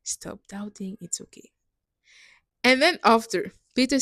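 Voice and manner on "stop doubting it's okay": soft, hushed self-talk; quietly talking herself out of fear; calming herself down. Retold or imitated. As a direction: soothe yourself under your breath. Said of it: "I calm myself down and tell myself".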